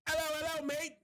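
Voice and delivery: high, hammed-up British accent; mock British accent. Someone with an accent hitting on him, mock British. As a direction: put on a silly high-pitched British chat-up voice. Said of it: "I fucking love accents".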